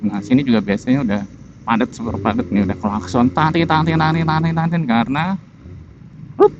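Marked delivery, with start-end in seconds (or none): 3.3-5.3 imitating horn. Honking rhythm imitated while riding.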